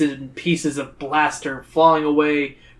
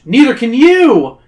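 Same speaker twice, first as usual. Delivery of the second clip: loud, high, drawn-out exclamation; dramatic reveal; 8 semitones higher, 9 dB louder, slower than usual. A narrator's reading. Reader springing the catch on listeners, dramatic exclamation. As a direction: punch out the twist with a big rise. Dramatic twist in book reading.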